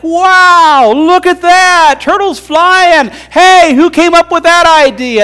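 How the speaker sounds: high, loud, drawn-out wonder; amazed turtles. Acted. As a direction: gasp in awe at the flying turtle. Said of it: "all the other turtles are like".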